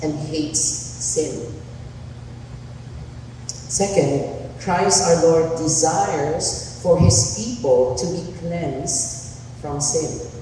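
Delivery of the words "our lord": normal talking voice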